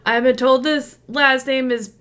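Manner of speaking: a hint of a baffled host; announcer reading. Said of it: "he's like".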